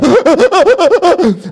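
loud, high stuttering fake sobs; hammed-up crying with weird pulsing noises; mock sobbing. Father re-enacting his tears at the barber's, loud mock sobs. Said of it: "you're crying and you're making weird noises".

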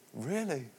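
said under the breath in mock dismay; small stunned reply. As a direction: drop to a tiny, stunned voice. Stunned reply to barman's news.

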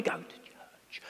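hushed and self-conscious, hiding the words. Retold or imitated. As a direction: fade into a near whisper. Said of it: "I was lowering my voice".

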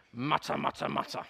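low, grumbling mutter; grumpy mutter. Shown as a demonstration. Preacher showing the grumpy Pharisee side how to mutter, low grumble. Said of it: "put on your grumpy faces and go".

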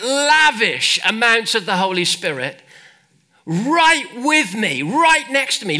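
loud, high, emphatic sermon delivery; impassioned preaching. Real, not acted. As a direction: preach it with full force, hitting the key words. Preaches at full force.

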